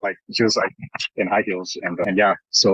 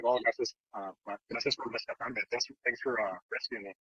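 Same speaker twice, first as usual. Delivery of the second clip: hushed thanks; 12 dB quieter than usual. Retold or imitated. Quietly thanking the girl.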